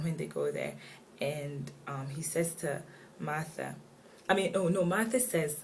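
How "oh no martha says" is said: ordinary talk, nothing marked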